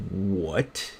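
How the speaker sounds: slow, drawn-out "what" of disbelief; mock stunned disbelief, haltingly; retold shock. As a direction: act out being stunned, stretching the words. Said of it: "stared at her in shock".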